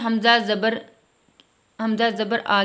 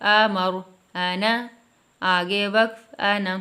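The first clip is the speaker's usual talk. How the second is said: pause-form drill; much slower than usual. Shown as a demonstration. Teacher showing children how to stop on words, drilled reading. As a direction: chant each word then its stopped form. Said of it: "اسی انداز سے".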